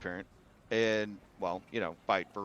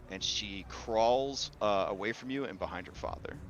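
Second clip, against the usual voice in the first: ordinary talk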